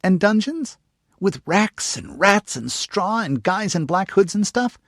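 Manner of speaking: put-on high, slow, relishing little girl; gruesome relish. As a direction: savor the gory details like an eager child. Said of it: "she said with gruesome relish".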